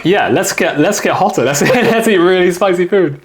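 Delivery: high-pitched, laughing imitation of eager locals; mock enthusiasm. Guest imitating Sichuan locals craving heat in summer, mock-eager. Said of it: "And they're like".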